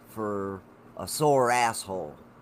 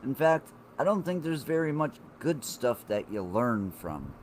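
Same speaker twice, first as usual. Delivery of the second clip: normal talking voice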